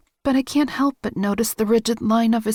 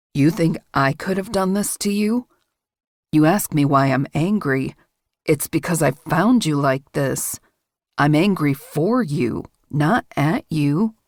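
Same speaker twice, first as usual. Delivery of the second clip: lowered male voice, firm and protective, slowing at the end; low intense man; 7 semitones lower than usual. A narrator's reading. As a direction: voice him low, angry on her behalf. Man explains his anger.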